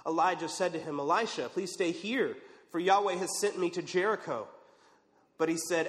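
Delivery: normal talking voice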